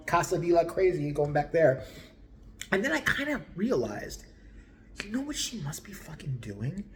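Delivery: ordinary delivery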